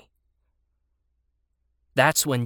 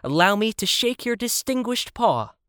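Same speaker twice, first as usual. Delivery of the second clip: high, pleased and formal; joyful; 9 semitones higher, 3 dB louder than usual. A narrator's reading. Child congratulates the rhino, joyfully pitched up. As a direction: offer congratulations with joy in a raised voice. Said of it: "I said with joy".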